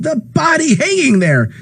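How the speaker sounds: high, frantic shout; horrified cry. Acted. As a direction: cry out in horror. Ed shouting at crowd.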